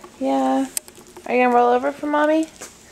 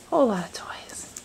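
whisper; 4 semitones lower, 5 dB quieter than usual. Real, not acted. Hushed aside to baby.

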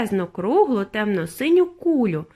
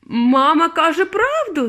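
raised, loud, drawn-out cry; father's yell; 7 semitones higher, 5 dB louder, slower than usual. A narrator's reading. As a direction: holler it out in alarm. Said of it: "заволав пан Борогар".

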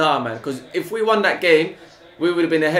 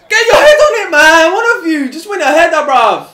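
high, loud anguished yells; real fan despairing at a wasted cross; frustrated shouting; 9 semitones higher, 7 dB louder, slower than usual. Real, not acted. Fan yelling at his team after missed cross, frustrated shouting.

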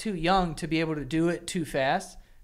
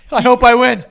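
mock whine; 6 semitones higher, 14 dB louder than usual. Friend mocking the kid's hope.

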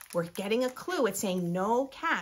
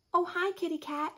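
put-on higher, friendly girl's greeting; little girl; 8 semitones higher than usual. Girl greeting the stray cat, higher friendly child voice. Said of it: "said the girl".

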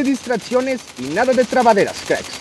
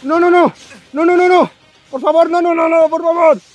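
high, frantic repeated nos; panicked pleading while fleeing; panicking gamer; 8 semitones higher than usual. Real, not acted. Nearly killed by hellhounds.

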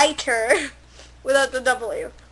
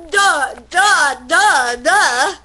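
louder da-dum beat; mock suspense theme; dramatic hum; 4 dB louder, much slower than usual. Reader voices a Hulk transformation, loud mock fanfare.

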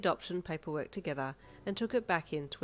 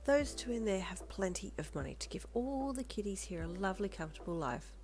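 hushed shushing into gentle reassurance; soothing; 4 semitones higher, 5 dB quieter than usual. A narrator's reading. Lolita hugs the crying child, soothing. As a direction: start near a whisper, then coax tenderly.

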